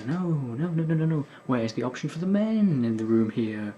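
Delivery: ordinary talk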